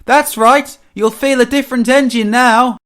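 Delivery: high, loud gloating all through; triumphant sustained mocking call. A narrator's reading. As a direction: crow the taunt back at full, high volume. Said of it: "called a voice".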